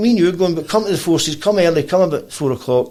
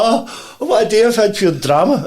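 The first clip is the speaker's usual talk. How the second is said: high-pitched, worked-up outpouring; dramatic relief; 8 semitones higher, slower than usual. Retold or imitated. Guest hugging her after getting past the bouncer, dramatic relief. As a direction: gush it like the ordeal is over.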